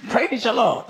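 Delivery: usual speaking voice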